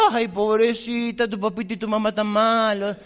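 mock pity. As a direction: pretend to coddle her. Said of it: "la gente está esperando que vos le digas". (lástima burlona, lenta y empalagosa)

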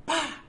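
high, sharp imitated shot "pah"; gunshot sound. Narrator imitates the killing shot, sharp and high. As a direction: pop out a gunshot. Man kills the other.

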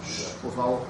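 soft hushing shh; librarian shushing whisper. Real, not acted. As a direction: quietly shush the room. Teacher hushing the class mid-lesson.

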